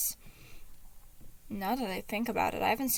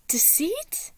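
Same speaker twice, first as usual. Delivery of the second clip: higher, breathless whisper; shaken whisper; 8 semitones higher, 4 dB louder than usual. A narrator's reading. Patton facing Deceit in his room, hushed shaken whisper. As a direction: barely dare to say his name. Chapter opens, Deceit again.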